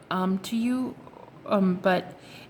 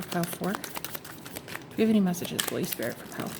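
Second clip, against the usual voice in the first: whispered, much softer, slowing down; hushed whisper; 2 semitones lower, 7 dB quieter than usual. Real, not acted. Tarot reader whispering a question to Holy Spirit.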